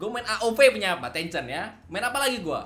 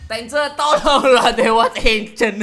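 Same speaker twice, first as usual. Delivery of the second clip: hammed-up pleading; 8 semitones higher, 8 dB louder, slower than usual. Begging the game god for loot.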